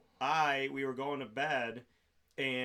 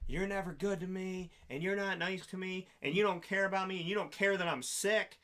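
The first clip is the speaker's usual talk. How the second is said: higher-pitched mock whining complaint; whiny drunk ex; 5 semitones higher than usual. Drunk wife complaining to husband over toast, high whine. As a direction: imitate a self-pitying drunk, list the grievances.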